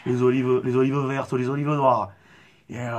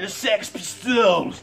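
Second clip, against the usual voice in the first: high, very slow English-accented shout; mock English accent; 6 semitones higher, much slower than usual. Shown as a demonstration. Actor showing off his accent, drawn-out English-accented cry. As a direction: stretch it out in a fake English accent. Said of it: "J'arrive à bien faire l'accent anglais".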